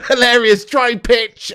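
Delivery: cracking up mid-phrase, words squeezed out through laughs; helpless giggling around the words; real laughter. Real, not acted. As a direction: lose it laughing at the excuse. Laughing at Klopp's excuse.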